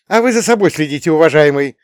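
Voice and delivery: high, loud, fast snap; barked retort. A narrator's reading. Mother to a passing man who criticised her son, barking. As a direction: bark it sharply at a meddler. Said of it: "рявкнула на него мама".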